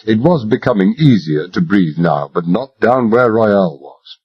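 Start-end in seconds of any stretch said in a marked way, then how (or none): none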